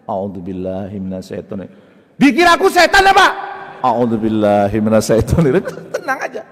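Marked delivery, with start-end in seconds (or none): 0.1-1.7 low, measured invocation; calm recitation. Acted. Calm prayer while wife scolds. 2.1-3.2 high, loud acted yell; hammed-up indignant shriek; wife scolding louder. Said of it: "Dimarahin lagi istri makin kenceng". 3.8-5.7 louder recitation. Acted. Reciting louder under provocation.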